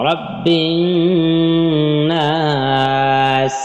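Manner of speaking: রাব্বিন then নাস held long and stopped at the end. Shown as a demonstration. Teacher showing students how to stop at নাস. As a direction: pause and stretch নাস to show the stop. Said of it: "যেখানে ওয়াকফ করে তাহলে কি হবে?".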